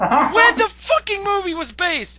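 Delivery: loud, high, slowed exasperated shout; exasperated yell. Real, not acted. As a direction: yell it in disbelief at the critic. Heated rant about a film critic.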